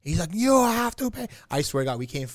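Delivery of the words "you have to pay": high-pitched mock-angry demand; hammed-up insistence, pitched high; heated. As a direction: imitate a worked-up taxi driver. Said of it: "he gets heated".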